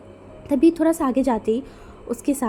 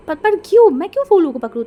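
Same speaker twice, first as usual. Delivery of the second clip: high, loud startled protest; 8 semitones higher, 8 dB louder than usual. A narrator's reading. Wenjing startled as a senior hands her a flower, shrill loud protest. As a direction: blurt it out in shock, much higher.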